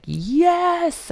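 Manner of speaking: high, drawn-out yes; retold triumph. Retelling finally getting the flared pants, mock-triumphant. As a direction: imitate a long-awaited victory cheer.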